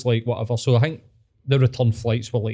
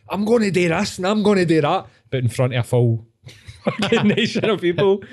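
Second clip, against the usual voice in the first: hyped-up Hitler; 6 semitones higher than usual. Retold or imitated. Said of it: "he's sitting there going".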